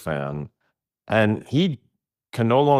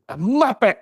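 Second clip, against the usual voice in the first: mock British insult. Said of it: "British insults are by far the best".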